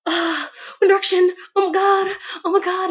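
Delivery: high, loud mock squeal over the band; hammed-up fangirl squeal. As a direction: gush like a starstruck fan. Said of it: "you're just".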